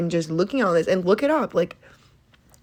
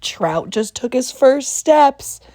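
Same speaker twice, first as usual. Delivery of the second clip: mock proud parent; 5 semitones higher, 5 dB louder, slower than usual. Proud parent announcing Trout's steps.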